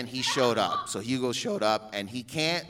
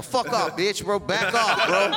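higher, half-serious telling-off; mock swearing; 7 semitones higher, 4 dB louder than usual. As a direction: act out warding off a pestering kid. Retold swearing at kid from costume.

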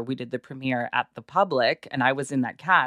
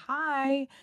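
high sweet greeting; 12 semitones higher than usual. Retold or imitated. Greeting a small child.

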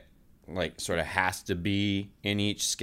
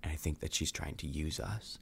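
conspiratorial low whisper-like voice; hushed; 3 semitones lower, 10 dB quieter than usual. Real, not acted. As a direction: keep it down so she can't hear. Secret talk while Kayla plugs ears.

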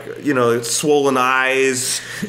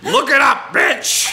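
high-pitched retold outburst; defiant insult; 12 semitones higher, slower than usual. Passenger insulting the flight attendant before the whole plane, defiant outburst. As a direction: throw it in her face, hammed-up. Acted insult climaxing the airplane quarrel.

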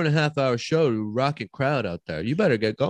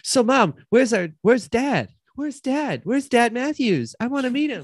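put-on high-pitched kid pleading; eager child; 5 semitones higher than usual. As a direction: ask like a wistful little boy. Boy asks mother for dad.

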